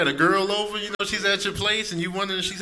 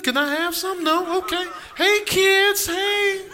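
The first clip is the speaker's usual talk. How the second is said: high pleading dog voice, climbing higher; pitiful hungry dog; 9 semitones higher than usual. Acted. Dog begging the family at dinner, pitiful. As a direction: beg politely in a high, hopeful voice. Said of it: "sit there and look at you, pitiful".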